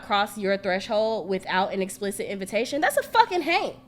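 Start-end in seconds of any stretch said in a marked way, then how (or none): 2.8-3.8 higher and rising in disbelief; pitched-up insistence; emphatic. Retold or imitated. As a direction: insist it's a Hank. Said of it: "I was basically telling her".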